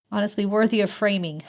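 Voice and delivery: ordinary talk, nothing marked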